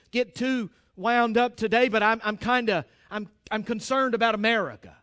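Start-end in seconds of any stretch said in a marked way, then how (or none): none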